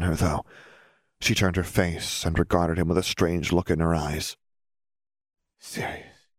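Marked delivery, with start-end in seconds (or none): 5.6-6.3 faint voiceless whisper; barely audible, hushed name. A narrator's reading. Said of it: "she whispered".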